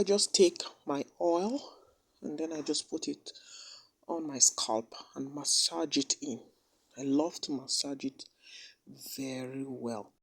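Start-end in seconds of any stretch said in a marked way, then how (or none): 2.2-10.1 whisper. Real, not acted. Whispering while massaging oil.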